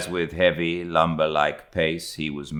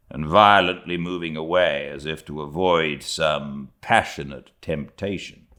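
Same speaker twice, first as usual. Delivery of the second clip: ordinary delivery, nothing marked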